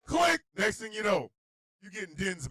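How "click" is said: high, loud hang-up click; sharp imitated hang-up; phone click. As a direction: punch out the click.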